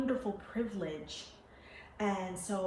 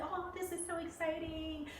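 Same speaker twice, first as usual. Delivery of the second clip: high, soft imitated squeal of delight; excited clerk; 10 semitones higher, 6 dB quieter than usual. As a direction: gush like a thrilled helper, kept small. Imitated clerk thrilled for her.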